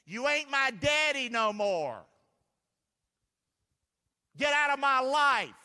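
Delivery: loud, high shout telling the devil off; preacher yelling at the devil; defiant shout. Acted. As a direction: throw the devil out at full voice. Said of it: "Mercy says it this way".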